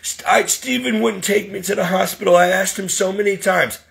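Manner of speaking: put-on aggrieved voice; mock complaining patient. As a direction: imitate someone playing the victim. Mimics Donna blaming him.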